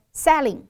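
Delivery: "selling" said as "salling"; a as in "cat" in "selling"; student's short A. Shown as a demonstration. Teacher imitating a student, short a for ay. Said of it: "还有一个同学说".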